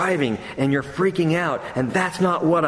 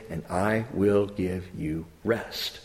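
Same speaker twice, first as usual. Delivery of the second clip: gentle promise; 8 semitones lower, 6 dB quieter, slower than usual. Acted. Slow soft scripture quote.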